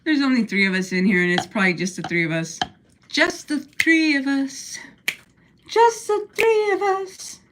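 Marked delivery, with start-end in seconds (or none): 3.1-4.8 slow drawn-out spooky voice; mock spooky. Teasing her two roommates, slow mock spooky voice. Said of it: "repeats the line playfully". 5.7-7.4 very high, slow sing-song. Pretend. Teasing her two roommates, high slow sing-song. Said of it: "repeats the line playfully".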